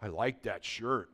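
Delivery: low, slow, quiet remark; imitated stranger. A stranger in California noticing the shirt, low and slow. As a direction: mimic a staring man's low, slow comment. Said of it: "this guy's standing there and".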